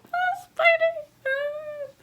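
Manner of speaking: very high, slow, drawn-out squeal; squeal, almost crying with joy; teary fan squeal. Retold or imitated. Her teary reaction to Spidey's moment.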